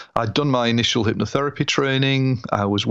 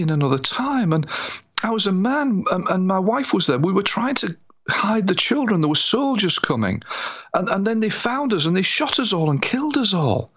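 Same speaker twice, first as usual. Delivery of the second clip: awed; 11 semitones higher than usual. Retold or imitated. Client recounts past life.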